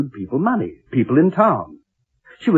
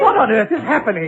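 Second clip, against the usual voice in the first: alarmed; 10 semitones higher than usual. Acted. Haunting chaos around them.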